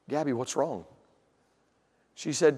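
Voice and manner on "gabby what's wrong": soft, low, concerned question; gentle concern. Retold or imitated. Father to his crying little daughter, gentle concern. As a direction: drop to a soft, low, tender voice.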